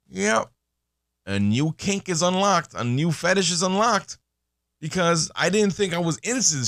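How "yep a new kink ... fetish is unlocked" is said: higher, game-like announcement voice; mock announcement. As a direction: announce it like a video game unlocking an achievement. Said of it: "and I go".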